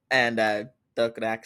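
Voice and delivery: usual speaking voice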